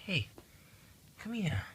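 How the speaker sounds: high, soft coaxing voice to a cat; high cooing at the pet; pet talk. Real, not acted. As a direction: coax the cat gently, way up high. Calling the cat over.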